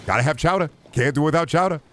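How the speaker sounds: high put-on voice, rising; Germa. Streamer riffing on chowder in an impression, high lilting voice. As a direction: pitch it up and lilt each phrase. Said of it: "take that as a German impression".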